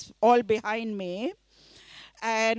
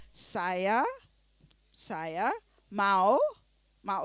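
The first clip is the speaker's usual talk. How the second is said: slow, word by word, each word repeated; said slowly; 4 semitones lower, much slower than usual. Shown as a demonstration. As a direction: spell it out slowly for a learner. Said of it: "Say it slowly".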